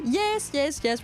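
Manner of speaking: ordinary talk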